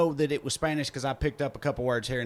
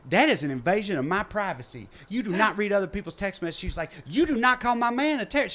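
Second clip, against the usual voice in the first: indignant; 6 semitones higher than usual. Retold or imitated. Lady defends her man indignantly.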